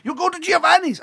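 put-on high, loud excited boy voice; a kid thrilled about takeaway, yelling; excited son.

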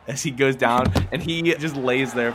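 ordinary talk

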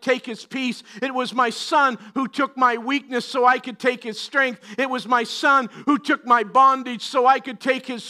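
loud, emphatic sermon cadence; repeated refrain, pounded out; full-force preaching. Acted. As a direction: press every line home from the pulpit. Preaching continues after God's words.

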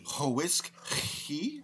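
"whiskey" very slow, opening on a separate "ho"; questioning, stretched "ho-whiskey"; exaggerated double H. Shown as a demonstration. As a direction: pull "whiskey" apart with extra H sounds. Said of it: "Two H's".

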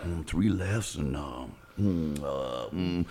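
low, soft puzzled mumbling; confused low mumble. Retold or imitated. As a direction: mumble it to yourself, lost. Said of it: "And so he's like".

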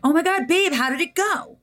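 high, eager "Oh my God, babe"; excited girlfriend greeting. Retold or imitated. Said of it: "And I was like".

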